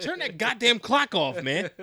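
loud, high, slow mock outrage; mock angry yell. Co-host joking about the rapper's clock, mock angry yell. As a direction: holler it in joking exasperation.

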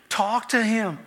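normal talking voice

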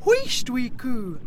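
slow, menacing shush; hushing kelpie. Acted. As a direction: hush her slowly like a predator. Kelpie hushes the calf.

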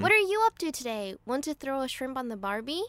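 careful attempt at an American accent. Shown as a demonstration. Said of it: "Oh, in an American accent?".

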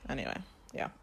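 soft whispered aside. Real, not acted. Moving the chat on, whispered aside. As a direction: breathe it out under the breath. Winding down the topic.

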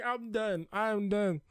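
exasperated quote. Retold or imitated. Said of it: "I was like".

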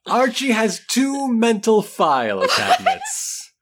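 giggling through the line; words broken by giggles; laughing. Real, not acted. Reader giggling mid-sentence.

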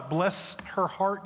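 a slow, drawn-out Southern "bless her heart"; Southern phrase. Shown as a demonstration. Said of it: "We have a phrase".

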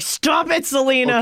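high playful shout of protest; mock yell at screen. Scolds the movie character, high mock shout.

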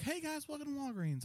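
very high, soft sugary greeting; sweet cashier greeting. Retold or imitated. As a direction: chirp it like an overly sweet cashier.

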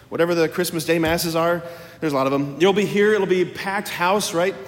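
ordinary talk, nothing marked

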